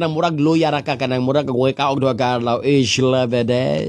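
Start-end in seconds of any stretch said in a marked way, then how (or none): 2.7-3.9 slow, lazy mock German accent. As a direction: say it like you're drained and weak, in German. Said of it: "Gusto na kag mga German accent".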